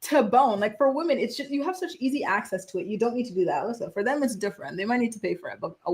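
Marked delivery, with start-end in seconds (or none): none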